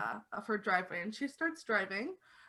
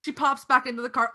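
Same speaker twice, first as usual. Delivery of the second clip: ordinary talk, nothing marked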